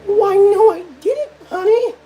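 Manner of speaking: very high falsetto reply; high-pitched mock sweetheart; high put-on voice. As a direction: answer in a squeaky falsetto. Answers himself in high voice.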